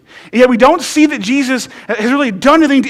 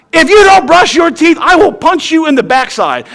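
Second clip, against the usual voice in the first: put-on higher, louder playful threat to a kid; hammed-up angry parent warning; mock scolding the seven-year-old daughter; 5 semitones higher, 4 dB louder than usual. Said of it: "threats of violence".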